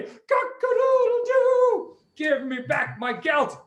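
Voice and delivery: high, loud crow with long drawn-out vowels; crowing rooster. Acted. Rooster to King Antiochus demanding its gelt, crowing loudly.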